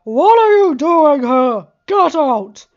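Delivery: very loud, slow booming command; thunderous voice. A narrator's reading. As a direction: boom it through the palace. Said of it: "a thunderous voice".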